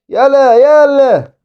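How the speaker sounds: high, slow, loud urging; urging shout. Real, not acted. Gamer urging his striker forward. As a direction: drag it out, pushing them on.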